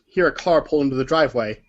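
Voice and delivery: normal talking voice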